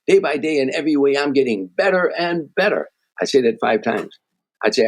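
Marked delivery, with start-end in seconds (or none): 0.1-2.9 raised pitch throughout, landing firmly on "better and better"; stress on BETTER. Shown as a demonstration. Speaker demonstrates his mirror mantra, shifting emphasis onto "better". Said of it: "put the emphasis on a different word".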